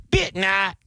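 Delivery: high-pitched Vietnamese-accented name; mock Vietnamese accent. Shown as a demonstration. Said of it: "say foreign words in their accent".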